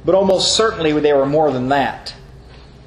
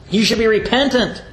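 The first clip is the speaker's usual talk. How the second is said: rebuking; 5 semitones higher than usual. Retold or imitated. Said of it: "rebuking him said".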